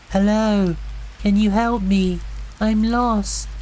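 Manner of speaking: high, pleading woman's call; lost woman calling. A narrator's reading. Lost woman in the woods begging the campers for help, high and pleading. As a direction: plead from far off, high and thin. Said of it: "The voice called again".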